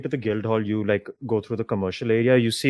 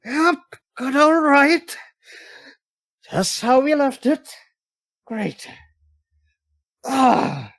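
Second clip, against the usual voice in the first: high, slow strained voice; beaten man recovering; 13 semitones higher, 3 dB louder, slower than usual. Acted. Beaten cheater picks himself up, high strained voice. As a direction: squeeze it out while slowly getting up. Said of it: "as he's slowly getting up".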